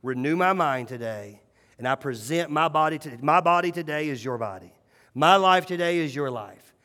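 a soft, earnest prayer said in the quiet of the morning. Shown as a demonstration. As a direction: pray it quietly, sincerely.

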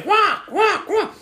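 very high-pitched squawks; piercing imitated bird cries; parrot squawk. That bird's a squawking away.

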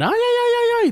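high, stretched mock Mexican "ay, ay, ay"; a mocking Mexican accent, crooned up high. Mocking LeBron's Taco Tuesday, in a mock Mexican accent. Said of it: "Speaking in the freaking Mexican accent".

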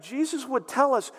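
ordinary talk, nothing marked

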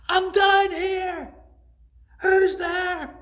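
high, loud, slow desperate shout; crying out. Acted. Jack shouts up to the unseen voice, frantic. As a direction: bellow upward, frantic. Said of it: "So he cried out again".

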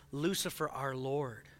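imitating the lady's sinister reading of LOL; ominous intonation. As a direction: intone it like a dark secret.

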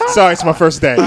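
high laugh breaking through apology. Pretend. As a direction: giggle out the excuse. Embarrassed newbie laughing at himself.

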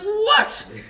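very high comic shriek of disbelief; mock outrage. Character hears his name's rival claim, mock-outraged. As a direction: imitate an incredulous, hammed-up outburst. Acted skit, outraged comeback.

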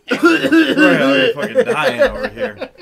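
slow, high laughing through words; cracking up mid-sentence. Real, not acted. Cracking up at the joke.

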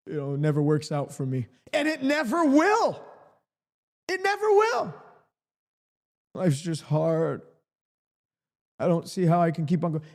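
mock defeated whine, low and deflated. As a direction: sound like you've given up. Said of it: "stop talking like this defeated, defeated, deflated".

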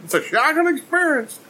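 high, hammed-up whimper; fake-sob the words; mock-tearful; mock cry. Mock crying about trauma.

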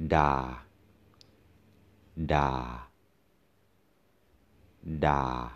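da held low and level, sinking slightly; Thai low tone. Shown as a demonstration. Teacher shows students the low tone, sunk da. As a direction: keep da down low. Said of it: "low tone".

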